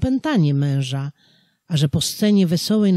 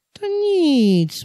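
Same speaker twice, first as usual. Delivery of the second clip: squeaky high little devil; 13 semitones higher, 5 dB louder than usual. A narrator's reading. The devil urging the farmer to drink, high and sly. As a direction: pitch it way up, sly and eager.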